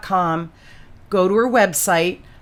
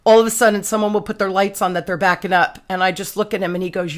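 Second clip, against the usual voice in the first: ordinary delivery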